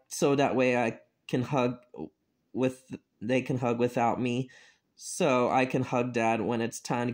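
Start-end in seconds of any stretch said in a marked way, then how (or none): none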